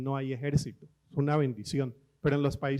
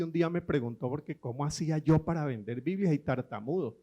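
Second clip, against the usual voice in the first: normal talking voice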